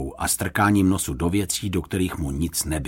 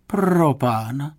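drawn-out, higher-pitched lament; 7 semitones higher, much slower than usual. A narrator's reading. Lady fretting as she runs to the kitchen, drawn-out lament. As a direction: wail it mildly, stretching the words. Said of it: "lamentovala paní Ptáčková".

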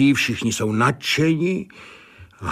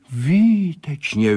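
delighted exclaim; 6 semitones higher, slower than usual. A narrator's reading. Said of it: "volal Sládek".

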